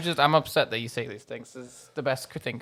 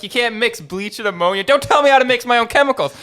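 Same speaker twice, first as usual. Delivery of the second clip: indignant Charlie rant; 7 semitones higher, 6 dB louder than usual. Retold or imitated. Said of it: "There's like".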